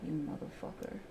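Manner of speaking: breathy whispered swearing; whispered curse. Real, not acted. Trader cursing the chart in a whisper. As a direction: hiss the curse under the breath.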